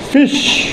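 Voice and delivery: फिश said in a whisper with a short i; teacher whispering फिश as a model word; model word, said unvoiced. Shown as a demonstration. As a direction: breathe out फिश with a short i sound. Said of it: "ये छोटे का फिश".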